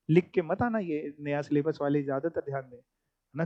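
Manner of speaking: hushed warning, tucked in beside the lesson; low cautionary aside. Real, not acted. Teacher cautioning new syllabus students, in a quiet aside.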